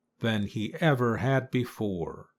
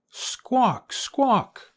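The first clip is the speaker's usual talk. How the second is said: very high, louder squawking in terror; terrified squawk; 13 semitones higher, 4 dB louder than usual. A narrator's reading. Chicken Little squawks louder in terror: high, panicked squawk. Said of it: "her call rising louder with her terror".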